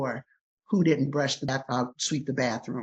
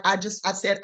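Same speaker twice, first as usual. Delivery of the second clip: normal talking voice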